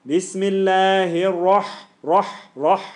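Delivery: slow, loud Bismillahir with a full, heavy "Rah". Shown as a demonstration. Teacher demonstrates the heavy letter for the class. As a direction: open the mouth wide for a heavy Rah. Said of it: "It's heavy".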